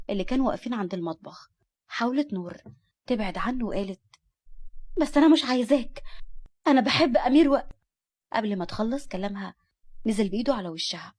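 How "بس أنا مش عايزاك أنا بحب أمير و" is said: raised, high protest; defiant outburst. A narrator's reading. Nour refuses her guardian and confesses love, loudly defiant. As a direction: blurt it out loud and desperate.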